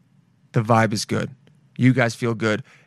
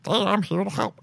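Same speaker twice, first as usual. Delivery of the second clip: higher, softly piped frog voice; little frog co-pilot; 6 semitones higher, 4 dB quieter than usual. Pretend. Little frog volunteers as the plane's co-pilot, high playful frog voice. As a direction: pipe up like a small frog. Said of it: "a little frog jumps up, and he goes".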